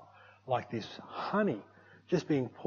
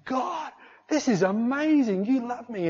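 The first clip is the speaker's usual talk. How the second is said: high, slow, loud cry of wonder; retold awed exclamation; 10 semitones higher, 6 dB louder, slower than usual. Worshipper breathless before God, crying out in wonder. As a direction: draw it out high, overwhelmed with amazement.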